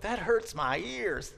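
high, drawn-out mock complaint; complaining student. Mimics students complaining about his speech.

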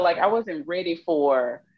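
ordinary talk, nothing marked

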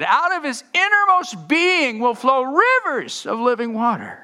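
high, loud ringing proclamation. Acted. Jesus proclaiming to the temple crowd, high and loud. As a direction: proclaim it across the courtyard.

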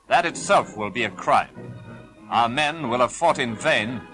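normal talking voice

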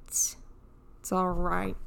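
slow, soft self-soothing; quiet, slow reassurance. Real, not acted. Repeats reassurance softer.

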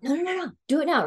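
high, pushy no-no-no; the friend won't take no; eager insisting. Retold or imitated. Raven urging her to read now, high eager insistence.

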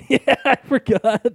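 slow, high words through laughter; cracking up while owning up; laughing admission. Real, not acted. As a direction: laugh your way through the confession. Laughing at own forgetfulness.

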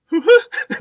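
high, loud hoo-ha through laughter; two-note hoo-ha. Retold or imitated. Retelling the old man's shocked reply, laughing hoo-ha. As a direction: repeat the stunned two notes, laughing. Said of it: "Just the two notes".

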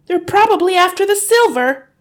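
very high, loud, agitated; a frantic mother pressing her husband; urgent hiss. A narrator's reading. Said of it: "hissed the mother".

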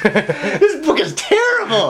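laughing disbelief. Real, not acted. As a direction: choke out the verdict laughing. Laughing at the book.

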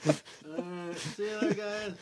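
off-mic, fading away; far from mic. Real, not acted. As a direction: call it from across the room. Leaving the mic.

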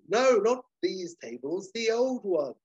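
high, slow emphatic correction; exasperated dealer. Acted. Dealer correcting the waiter in the dining room, high slow insistence. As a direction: insist on it with strained patience. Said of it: "And the dealer's like".

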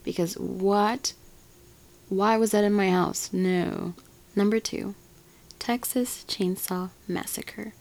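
ordinary delivery

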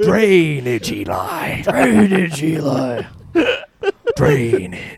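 loud, high, strained bellowing of drainage; Plainview shout impression. Host bellows Plainview's drainage line at his friends. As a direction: yell it like a furious oilman. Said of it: "say Daniel Plainview's famous line".

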